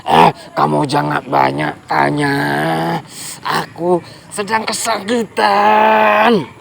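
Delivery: a gruff beast in pain, brushing off questions; a crocodile too sore to chat, slowing at the end; grumpy crocodile. Acted. Crocodile snaps at grasshopper.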